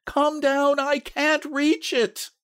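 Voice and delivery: put-on old woman calling high, straining and panting; loud cry. A narrator's reading. Wife calling her husband downstairs, straining loud cry. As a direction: call high, stretching the last words. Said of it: "she cried loudly".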